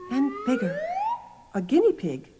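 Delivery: ordinary talk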